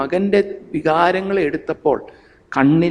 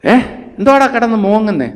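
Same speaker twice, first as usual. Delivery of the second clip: mocking scolding mother; 3 semitones higher than usual. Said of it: "അമ്മയുടെ dialogue എന്തുവാ?".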